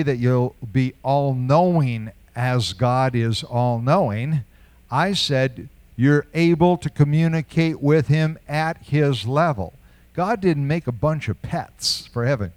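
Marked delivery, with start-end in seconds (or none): none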